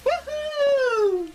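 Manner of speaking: excited. Pretend. Cheer after song.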